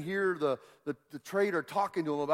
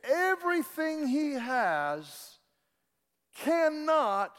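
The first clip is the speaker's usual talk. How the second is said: preaching at full force; 9 semitones higher, slower than usual. Acted.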